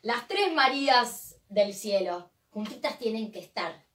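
recite, lifting the last line; rhymed recital. Acted.

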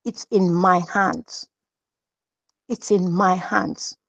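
slow hushed voice of God. Retold or imitated. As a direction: let each word land slowly like a divine reply. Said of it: "the voice said to me".

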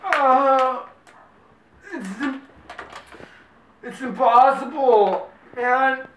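high, strained words round a mouthful of water; talking with mouth full. Real, not acted. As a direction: speak through a mouthful of water.

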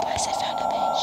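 hushed whisper to the viewers; secretive, voiceless aside. Real, not acted.

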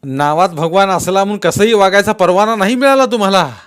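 loud, high rebuke; angry scolding. Acted. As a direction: scold him with contempt.

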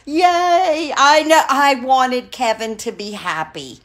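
high, loud, happy yay; delighted cheer. Real, not acted. Fan cheering that Kevin is happy, delighted. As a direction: cheer them on with glee.

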